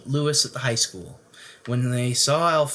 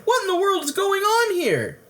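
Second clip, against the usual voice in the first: much higher, astonished demand; surprised and alarmed; shocked cry. Acted. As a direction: parents baffled by the construction. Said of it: "they cried".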